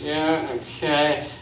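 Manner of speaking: ordinary delivery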